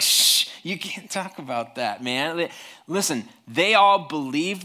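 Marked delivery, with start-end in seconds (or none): 0.0-2.4 drawn-out shush, then slow scolding; slow, hammed-up hushing; hushing an insensitive friend. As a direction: shush him with a mock reproach. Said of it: "And everybody's like".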